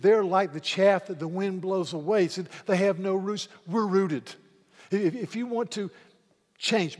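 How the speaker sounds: loud, driving sermon climax; impassioned loud preaching; full-force preaching. Real, not acted. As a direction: preach it with fire to the congregation.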